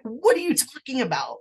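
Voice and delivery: high, baffled retort, retold; imitated disbelief; caught off guard. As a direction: act out being thrown by surprising news. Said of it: "completely caught off guard".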